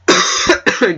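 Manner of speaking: dry cough. Real, not acted. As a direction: cough mid-sentence. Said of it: "I can".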